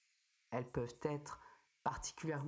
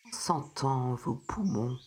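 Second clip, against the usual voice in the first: soothing spa whisper; 3 semitones lower, 8 dB louder than usual. Acted. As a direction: ease the listener gently into calm. Guides a breathing meditation.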